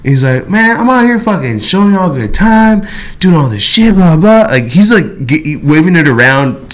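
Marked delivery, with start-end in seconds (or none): 0.5-4.4 worked-up dealer voice, pitched high; retold agitated griping, animated and higher; imitated upset rant. Storyteller imitates upset, animated dealer waving a knife, high-pitched griping. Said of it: "getting upset at us".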